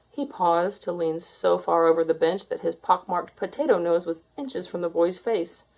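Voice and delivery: ordinary delivery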